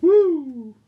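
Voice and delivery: high-pitched "woo" cheer; excited whoop. Real, not acted. Game master cheers a player's roll, excited whoop.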